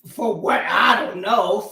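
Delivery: usual speaking voice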